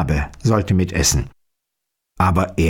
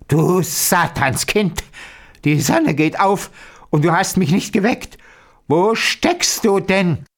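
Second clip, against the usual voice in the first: put-on higher, scolding old witch; angry witch cry; 7 semitones higher than usual. A narrator's reading. Old witch scolding the girl for not waking her, crying out. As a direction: cry out crossly, stretching the first and last words. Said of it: "sprang ... aus dem Bett und schrie".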